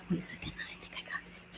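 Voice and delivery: whispered aside to herself; whispering. Real, not acted. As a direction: drop to a whisper while fiddling. Muttering to herself mid-task.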